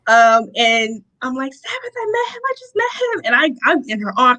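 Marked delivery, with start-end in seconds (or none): 1.5-3.2 re-enacted overwhelmed cry, high and soft; mock tearful. Telling her friend she just met him, mock tearful squeal. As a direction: squeal it softly as if tearfully overcome. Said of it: "I'm crying".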